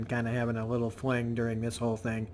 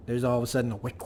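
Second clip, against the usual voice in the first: ordinary talk, nothing marked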